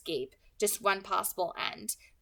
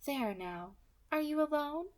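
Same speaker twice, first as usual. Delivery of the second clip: light, higher, smooth villain voice; 5 semitones higher than usual. Acted. Kidnapper calmly threatening the girl on the phone, pleasant and light. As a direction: menace wrapped in a pleasant voice.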